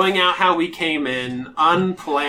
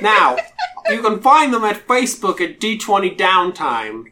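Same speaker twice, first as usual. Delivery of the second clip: imperious British drow priestess; 5 semitones higher, 6 dB louder than usual. Pretend. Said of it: "how all of the Imperious Drow priestesses will speak".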